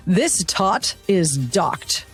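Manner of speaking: ordinary talk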